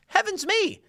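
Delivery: high, hammed-up gasping outrage; a prissy mock-scandalized exclamation; mock shock. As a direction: clutch your pearls in fake horror.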